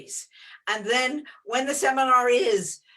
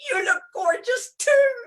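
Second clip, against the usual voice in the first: very high, loud playful squeal; mock gushing; 12 semitones higher, 5 dB louder than usual. Retelling her comeback to the big Texan, mock gushing. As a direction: throw the compliment back in a high, hammed-up voice.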